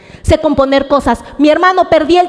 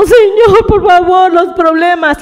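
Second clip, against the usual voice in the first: higher, louder whimpering prayer, mock crying; mock crying plea; 5 semitones higher, 4 dB louder than usual. Preacher mocking a believer who cries instead of acting, mock sobbing plea. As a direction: beg the Lord like a crybaby. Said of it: "No estoy acá llorando".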